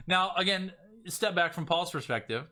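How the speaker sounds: ordinary delivery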